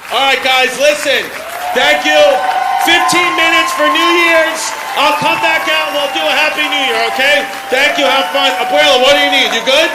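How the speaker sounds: loud, high shout over the music; calling out. Real, not acted. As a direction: holler to the whole crowd over music. Calls out to crowd over music.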